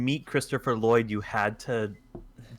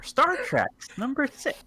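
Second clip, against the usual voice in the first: announcer voice; 13 semitones higher than usual. Pretend. Announcing Star Trek, dramatic voice.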